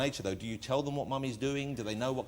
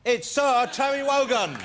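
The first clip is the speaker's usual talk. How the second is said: shouted, slow, much higher announcer call; grand announcement; 11 semitones higher, 9 dB louder, slower than usual. Real, not acted. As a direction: belt out the guest's name like a ringmaster. Host announcing guest entrance.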